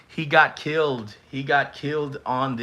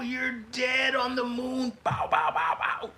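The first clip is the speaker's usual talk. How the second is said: much higher, playful yelling with mock gunshot sounds; hype mock announcement; 11 semitones higher, 3 dB quieter than usual. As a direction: call the kill like a hype man. Mock death announcement with sound effects.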